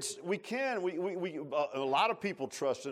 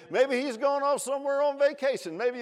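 high mocking jeer; teasing sneer, pitched up; sarcastic taunt; 8 semitones higher than usual. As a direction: rub it in with sarcasm. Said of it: "he got real sarcastic".

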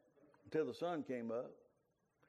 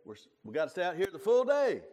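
firm grandpa; 6 semitones higher, 10 dB louder than usual. Retold or imitated. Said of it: "Papa said".

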